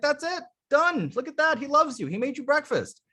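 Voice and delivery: overjoyed like it settles everything; playful mock excitement. Shown as a demonstration. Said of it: "It's way more playful".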